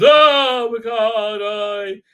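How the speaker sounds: high, loud drawn-out wail; hammed-up theatrical exclamation; mock outcry. Acted outburst at Picard.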